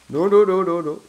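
loud, rising panicked refusals. Real, not acted. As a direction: back away shouting no. Panicking at game enemy.

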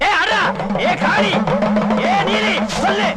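high-pitched ritual commands; commanding exorcism cries. Acted. Priest driving a spirit out of the bride, crying commands high. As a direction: order the spirit away in high, ringing cries.

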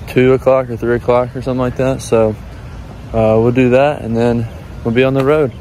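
ordinary delivery

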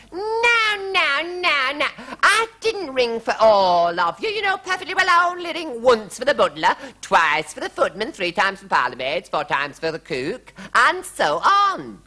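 high, loud, fussy royal telling-off; piercingly high queen, counting it out; scolding queen. A narrator's reading. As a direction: scold the servants as an exasperated queen. Queen scolds crowding servants.

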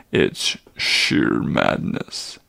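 put-on an old creature barely holding his agitation; agitated old centaur. Old Chiron to the boy, low troubled voice.

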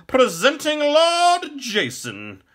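very high, loud, drawn-out announcement; proud herald. A narrator's reading. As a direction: proclaim the guest slowly to the whole hall. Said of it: "in a proud voice".

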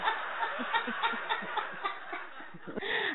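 high, breathless laugh; real laughter. Real, not acted. Jesus player laughs at the confession, real laughter. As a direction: burst into helpless high laughter.

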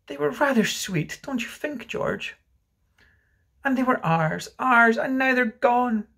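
high, plaintive voice, slowing at the end; grieving mother. Acted. Mother speaks to George of children gone, plaintive. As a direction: lament in a high voice, drawing out the loss.

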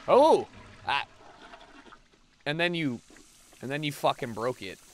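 ordinary delivery

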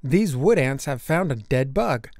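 plain reading voice

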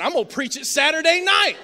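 loud, high, triumphant shout; excited exclamation. Retold or imitated. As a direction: crow it out like a fired-up preacher. Answers wife's cheer, exclaiming.

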